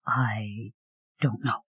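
very soft and hesitant. A narrator's reading. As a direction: barely voice it, unsure. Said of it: "Gwena replied hesitatingly".

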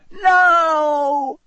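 high, loud, drawn-out creature voice; cold voice. A narrator's reading. Cold creature in the robes to its servant, high piercing voice. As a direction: stretch it out on one very high note. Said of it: "said the cold voice".

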